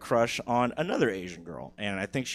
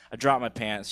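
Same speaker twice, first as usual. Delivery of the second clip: usual speaking voice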